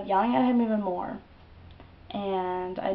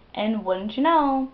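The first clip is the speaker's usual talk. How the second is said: excited; 5 semitones higher, 5 dB louder, slower than usual. Retold or imitated. Dream twist, mock delight.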